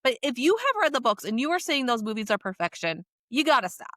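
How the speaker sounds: ordinary talk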